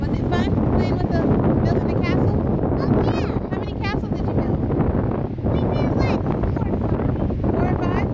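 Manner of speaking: high, sing-song mom voice. Real, not acted. Mother talking to little girl.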